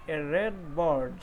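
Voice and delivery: "red bird" read very slowly and clearly; slow model reading. Shown as a demonstration. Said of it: "पहला जो sentence है".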